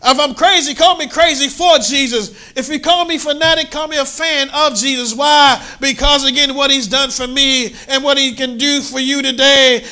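high, loud fervent preaching; preaching at full force. Acted. Preacher fervently telling church he's crazy for Jesus. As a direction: preach it hard to the congregation.

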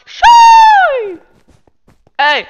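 high loud shoo; shouting. Real, not acted. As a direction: shout them away. Shooing attackers away.

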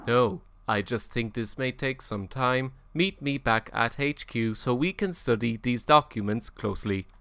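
slow, low robot voice; monotone robot reading. Pretend. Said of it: "trying to make it sound like a robot".

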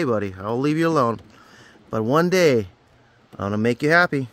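ordinary talk, nothing marked

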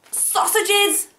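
high, drawn-out sausages; excited sniff. Acted. Reading to a child, dog smells sausages, excited. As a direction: savour the word like a hungry dog.

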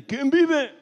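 sharp high challenge from a soldier; soldier's challenge. Acted. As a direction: call out like a guard in the dark. Soldado grita en el chiste. (grito de soldado que vigila)

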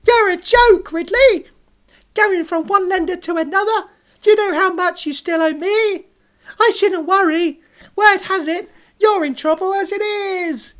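very high, loud puppet threats; scornful squawk, drawn out at line ends; cartoon loan shark. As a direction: threaten him in a piercing cartoon voice. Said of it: "the voice is like, um, thingy from Sonic High School".

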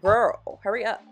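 loud, sassy hurry-up; sassy loud retort. Retold or imitated. Impatient retort to slow client.

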